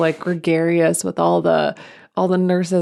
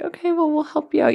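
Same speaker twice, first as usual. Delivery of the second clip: sweet reassuring nurse; 10 semitones higher than usual. Retold or imitated. Nurse promises the girl help, high and gentle.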